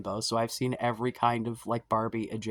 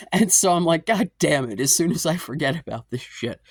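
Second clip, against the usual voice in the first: louder, higher exasperation; exasperated; 5 semitones higher, 7 dB louder than usual. Real, not acted. Exasperated retelling.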